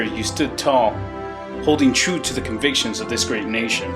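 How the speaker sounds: ordinary talk, nothing marked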